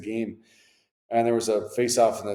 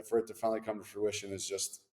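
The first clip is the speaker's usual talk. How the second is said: ordinary talk, nothing marked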